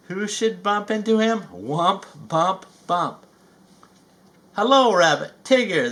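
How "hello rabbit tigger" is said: loud, high startled greeting; surprised cry. Acted. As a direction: blurt it out, caught off guard. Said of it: "cried in surprise".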